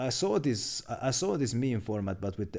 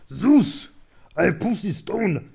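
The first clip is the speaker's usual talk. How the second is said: much louder and higher, slow and strained hero voice; Kratos impression; 8 semitones higher, 11 dB louder, slower than usual. Streamer's announced Kratos take, loud slow strained roar. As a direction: roar it at Zeus like a furious god-killer. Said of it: "I'm gonna do my best Kratos impression".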